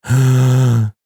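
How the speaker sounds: loud, long intake of breath; big gasp. Acted. Bracing for a very long village name, loud gasp.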